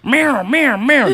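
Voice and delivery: high, wailing cat impression; imitated insistent meows; cat meowing. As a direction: yowl like a cat in a carrier. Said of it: "she's like".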